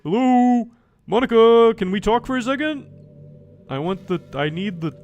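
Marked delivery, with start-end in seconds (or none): 0.0-2.9 loud, high call for attention. Real, not acted. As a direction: call out to get her attention. Calling out to the game character.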